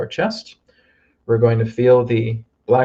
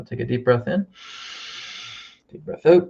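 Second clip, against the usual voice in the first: breathing cue in a healing session; guided breathing. Shown as a demonstration. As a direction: lift on the out-breath. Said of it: "repeat after me".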